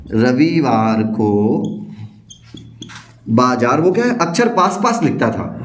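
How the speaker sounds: ordinary delivery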